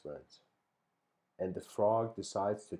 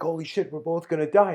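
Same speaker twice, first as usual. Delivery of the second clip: higher, louder, fast panic; panicked frog; 8 semitones higher, 10 dB louder, faster than usual. Retold or imitated. Stung frog realizing they will both drown, panicked. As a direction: blurt it out in a rush of alarm.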